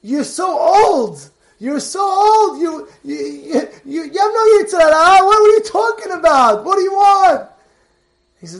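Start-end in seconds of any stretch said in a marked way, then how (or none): 0.0-1.3 slow, drawn-out scoff; mocking wife. Wife scoffing at her old husband, slow and mocking. 1.6-2.6 slow, higher, sharper scoff; mocking wife. Wife scoffing again at her old husband, higher and mocking. 2.6-7.5 loud, high, half-crying scolding; exasperated wife. Retold or imitated. Wife scolding her husband loudly, near tears.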